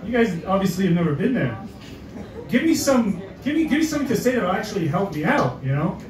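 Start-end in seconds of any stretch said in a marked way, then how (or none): none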